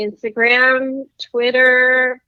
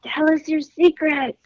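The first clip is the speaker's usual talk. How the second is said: raspy voice; 6 semitones higher than usual. Acted. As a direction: rasp it out. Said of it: "tell us your secret in a raspy voice".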